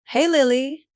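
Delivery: calling out. A narrator's reading. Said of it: "familiar voice calling my name".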